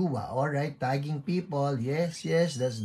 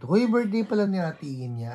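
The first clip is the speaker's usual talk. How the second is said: higher, louder, quick retold exclamation; excited quoted reminder; 8 semitones higher, 7 dB louder, faster than usual. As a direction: imitate a friend suddenly remembering a birthday. Quotes a friend's sudden birthday reminder. (masiglang panggagaya ng biglang pagpapaalala)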